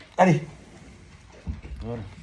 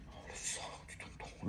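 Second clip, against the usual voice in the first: whisper; 23 dB quieter than usual. Real, not acted. Late-night aside to brother.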